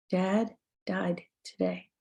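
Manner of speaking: quiet grief. Retold or imitated. Griever voicing the loss aloud.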